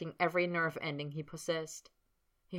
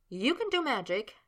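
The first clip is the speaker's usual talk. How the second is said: woman's voice; 7 semitones higher than usual. A narrator's reading. Sabrina voice.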